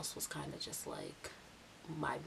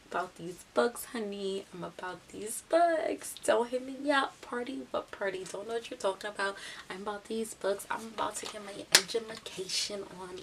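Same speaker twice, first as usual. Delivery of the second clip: sassy deflection; 3 semitones higher, 6 dB louder than usual. Retold or imitated. Student dodging all talk of her party, sassy. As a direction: shut the topic down with exaggerated sass.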